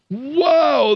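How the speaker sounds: a real jolt of disbelief; stunned exclamation; amazed wow. Real, not acted. Reacting to outrageous candidate behavior.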